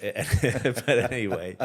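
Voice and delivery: real chuckle through the words; brushing it aside with a laugh. Real, not acted. Laughs at own claim.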